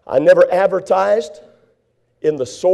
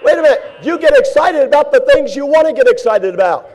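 normal talking voice

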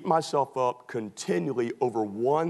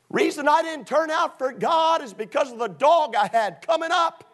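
whiny excuse maker; 11 semitones higher, 4 dB louder than usual. Retold or imitated. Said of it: "I have people all the time say".

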